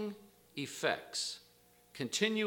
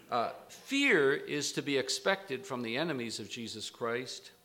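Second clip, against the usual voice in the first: ordinary delivery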